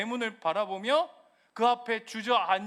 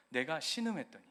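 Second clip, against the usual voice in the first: ordinary talk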